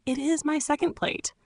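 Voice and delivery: sharing his plate with a hungry stranger, gently; gentle. Retold or imitated. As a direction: kindly urge them to eat. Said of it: "saying gently".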